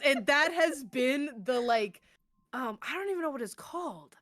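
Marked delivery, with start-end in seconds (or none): none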